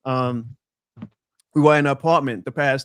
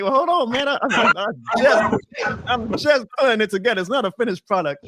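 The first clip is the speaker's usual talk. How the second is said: higher-pitched retold defensive protest; mock protest; 8 semitones higher than usual. Guest replaying his reply to a teasing friend, mock protest.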